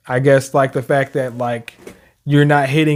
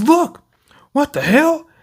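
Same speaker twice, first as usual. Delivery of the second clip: high alarmed voice; 9 semitones higher than usual. Retold or imitated.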